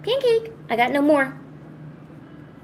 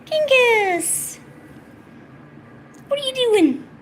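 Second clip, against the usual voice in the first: very high, squeaky cooing; high cutesy questioning; 12 semitones higher, much slower than usual. Real, not acted. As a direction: coo at your pet in a falsetto. Baby talk to pet.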